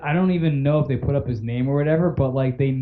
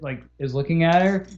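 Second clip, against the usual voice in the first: ordinary talk, nothing marked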